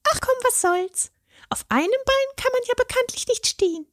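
very high fairy voice, playful; a fairy cracking a joke. A narrator's reading. The fairy jokes as she hands another leg, high and playful.

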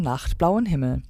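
plain reading voice

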